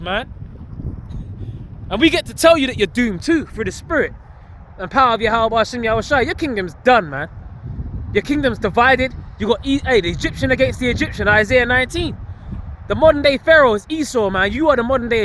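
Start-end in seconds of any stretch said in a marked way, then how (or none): none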